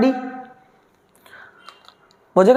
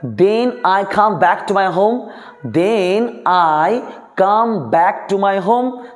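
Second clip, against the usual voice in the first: slow dictation; much slower than usual. Shown as a demonstration. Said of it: "teacher dictating".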